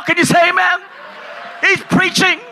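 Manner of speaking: ordinary talk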